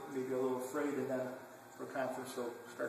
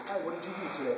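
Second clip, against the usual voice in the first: mock chatty greeting; 4 semitones higher than usual. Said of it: "the same thing as me going".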